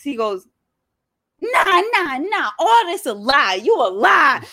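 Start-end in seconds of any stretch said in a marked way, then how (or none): 1.4-4.4 frantic mock denial; high accusing shout. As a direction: yell it high, refusing to believe. Quoting friend accusing.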